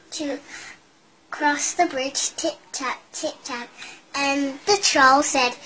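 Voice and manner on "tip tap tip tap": soft, light tip-tap; quiet tip-tap. A narrator's reading. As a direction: tiptoe the tip-tap softly. Small goat crossing quietly.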